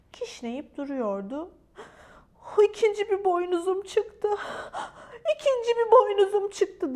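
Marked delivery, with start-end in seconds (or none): 2.6-6.9 high-pitched wailing complaint; hammed-up tearful cry. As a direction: wail it like an upset unicorn. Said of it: "diye ağlıyordu".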